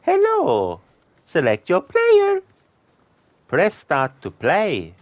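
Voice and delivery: high, cartoonish Italian plumber; squeaky Mario game voice. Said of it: "It's a me, Mario".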